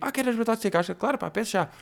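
high, eager imitation of the waiter; high-pitched fawning waiter; mock waiter voice. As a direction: chirp it up like an overly keen waiter. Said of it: "O senhor ouve é tipo".

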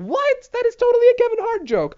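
very high, loud squeaky disbelief; astonished squeal. Real, not acted. Comic realising he took another comedian's joke, astonished squeal.

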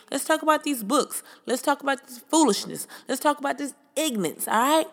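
sweet sign-off to followers; social media plug. Acted. Plugs her social media.

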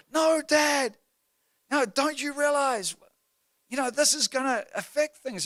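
put-on high, exasperated child voice; dismayed daughter imitation. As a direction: plead like a kid who can't believe Dad, pitched high. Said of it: "She hears about it".

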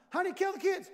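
high, loud mock-frantic wife. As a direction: call it out like a wife at wit's end. Acts wife demanding discipline.

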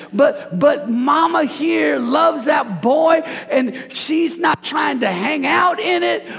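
rising preacher fervor; preaching build. Real, not acted. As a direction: pound it home to the congregation.

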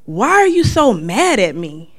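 a hurt mom asking her daughter; lightly voiced wounded mother; mom's hurt plea. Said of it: "she would say stuff like".

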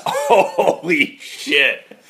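slow, laughing disbelief. Real, not acted. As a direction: let the laugh carry the words. Laughing at the story's punchline.

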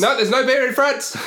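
high, loud mock outrage; loud protest. Real, not acted. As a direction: cry it out in mock disbelief.

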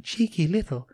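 put-on small Welsh child's voice; Welsh five-year-old. Host acts toddler starting a video, Welsh child. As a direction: put on a little Welsh girl. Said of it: "that was a young Welsh five-year-old's voice".

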